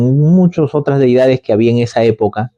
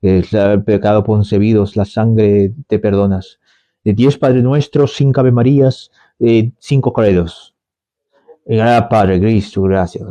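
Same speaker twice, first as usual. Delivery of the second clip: low, easy absolution; a hint of a lax priest forgiving; lightly voiced priest; 5 semitones lower than usual. Priest absolving a sinner, lower and relaxed.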